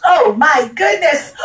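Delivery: high, startled ooh; startled king. Acted. The stung king wakes and cries out in surprise. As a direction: jolt awake in surprise. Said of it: "he says".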